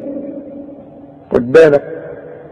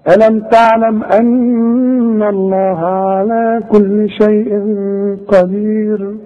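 slow melodic tajweed chant; Quran recitation; 4 semitones higher, slower than usual. Acted. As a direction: recite slowly with held vowels.

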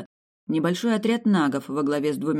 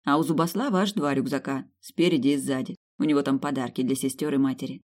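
ordinary delivery, nothing marked

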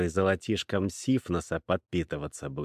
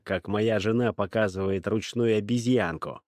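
ordinary delivery, nothing marked

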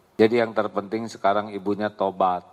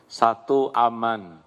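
ordinary delivery

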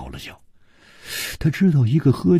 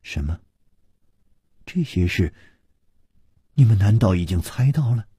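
understated surprise; faster than usual. A narrator's reading. Liu learning they had guessed it, mildly surprised. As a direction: let a little astonishment show. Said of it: "露出了惊讶的神".

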